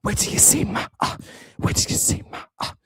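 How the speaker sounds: unvoiced rap-style whisper; hushed mocking imitation; mock whisper song. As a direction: whisper it like a parody of a rap hook. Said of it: "doing the whisper song".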